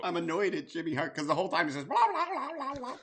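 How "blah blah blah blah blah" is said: high, squawky turkey gobble; gobbling turkey voice. Retold or imitated. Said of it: "Oh shit, I did a turkey impression".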